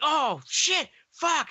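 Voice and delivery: high, squeaky mock curse; panicked cursing. Derek dropping a crack rock, high panicked cursing. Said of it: "he's cursing".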